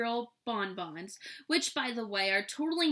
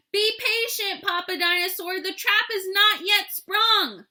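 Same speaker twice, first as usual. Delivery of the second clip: high, loud, insistent call; loud dinosaur scolding; 10 semitones higher, 8 dB louder than usual. A narrator's reading. Dinosaur holding Papa back from the trap, loud high scolding. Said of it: "another loud noise that sounded kind of like".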